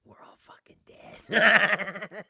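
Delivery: slow, breathy doomed stage whisper; hoarse stage whisper. Retold or imitated. Officials realising they are finished, slow stage whisper. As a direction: drag it out like a doomed man's whisper. Crowd's doomed reaction, retold.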